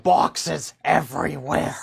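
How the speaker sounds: slow, dazed cat voice; voicing the cats. Teacher voicing her cats, slow and dazed. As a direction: speak as a cat overwhelmed by boxes. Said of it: "The cats are like".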